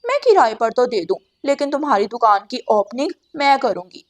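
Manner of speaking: loud, mocking crow neighbour; scheming crow. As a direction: sneer like a greedy crow. Crow Gauri bargains.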